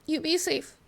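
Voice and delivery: put-on small child's high voice. Child Lucia says goodbye.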